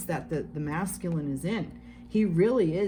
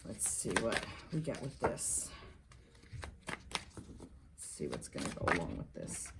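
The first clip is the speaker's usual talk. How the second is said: muttering to herself; 12 semitones lower, 13 dB quieter than usual. Real, not acted. Muttering to herself while shuffling.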